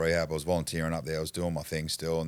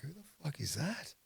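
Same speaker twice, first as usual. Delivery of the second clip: amazed; 12 semitones higher, 8 dB quieter than usual. Retold or imitated. Seeing grown daughter.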